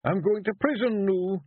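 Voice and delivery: high, Scottish Feegle warrior protesting; high Scots pixie voice wheedling his way out. A narrator's reading. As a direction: plead like a small nervous Scottish warrior. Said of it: "he asked".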